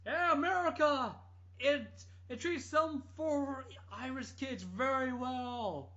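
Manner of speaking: high, slow, gushing mock cheer. Parodying the singer praising America, hammed-up gush. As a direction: ham it up like a grateful rock star. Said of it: "And then it was like".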